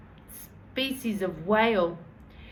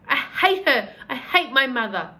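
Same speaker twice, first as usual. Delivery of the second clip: put-on louder, higher, bitter child's outburst; a girl telling a tramp she hates her mother, loud and bitter; angry outburst; 3 semitones higher, 6 dB louder than usual. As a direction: spit out the hatred of her mother. Chloe's outburst.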